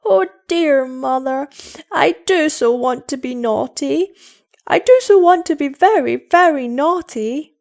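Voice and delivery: put-on high, loud childish wail; sobbed. A narrator's reading. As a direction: plead like a sobbing small girl, acted. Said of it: "she sobbed".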